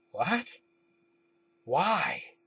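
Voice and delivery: put-on puzzled questions in a British accent; confused British accent. A narrator's reading. Narrator questions Green about the dismissal, confused British accent. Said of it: "I asked, confused but in a British accent".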